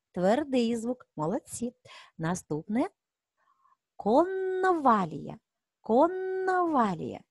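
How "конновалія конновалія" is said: "Конновалія" slow and high, holding the н long; stretched N sound. Shown as a demonstration. Teacher helps children hear the sound, "Конновалія" with a long н. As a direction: draw out the hard н inside "Конновалія". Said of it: "Який звук?".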